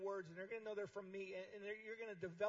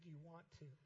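"Do you want to?" said much softer, falling, with "Do" leaned on; 2 semitones lower, 10 dB quieter than usual. Shown as a demonstration. Jesus asking the crippled man softly, "Do" leaned on, falling. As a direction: ask "Do you want to?" quietly, leaning on "Do". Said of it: "You control the "do you want to".

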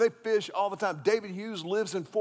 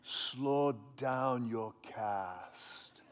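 drawn-out low instruction; slow it down; 7 semitones lower, 8 dB quieter, much slower than usual. Retold or imitated. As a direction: stretch each word out slowly. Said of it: "he goes".

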